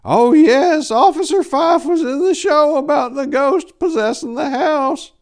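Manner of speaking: put-on very high, loud old-lady falsetto; old lady Pickles. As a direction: squeal it out like a frantic old woman. Old lady insists again.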